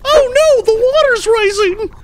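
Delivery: very high falsetto wail; hammed-up panicked victim, squealed high; mock panic. Player imagining someone cooking at home, mock panicked squeal. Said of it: "someone, like, at home cooking a steak going like".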